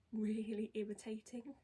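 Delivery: ordinary delivery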